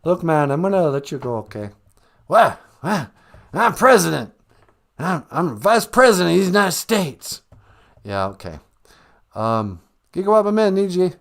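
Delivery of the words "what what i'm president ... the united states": confused, loud, higher blustering; Joe Biden. Acted. President muddling his own title, confused bluster. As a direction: blurt it out, muddled. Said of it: "Mister President".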